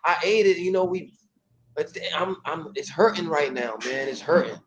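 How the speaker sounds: exaggerated, pitiful moaning; hammed-up stomach-ache whine. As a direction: overplay the pain to blame your friend. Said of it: "just play along with me with this".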